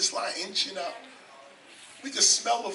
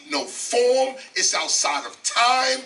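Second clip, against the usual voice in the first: usual speaking voice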